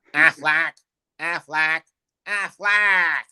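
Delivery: high quacking duck call; Aflac duck. Retold or imitated. Mimicking the insurance duck, high and quacky.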